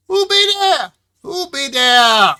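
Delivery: put-on loud, high old-timer holler; old man. Old Wilbur answering a knock from inside his shack, hollering. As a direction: yell out like a startled old codger. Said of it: "he is an older gentleman, quite old".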